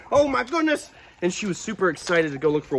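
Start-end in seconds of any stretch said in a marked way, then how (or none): 0.1-0.9 high, startled oh my goodness; startled exclamation. Real, not acted. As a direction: jump at the surprise. Reacting to dog bite.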